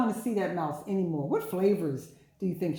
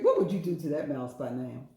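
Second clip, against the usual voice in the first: usual speaking voice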